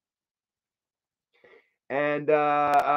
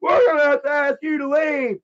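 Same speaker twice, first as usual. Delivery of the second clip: mock usher; 9 semitones higher, 3 dB louder than usual. Usher repeats request.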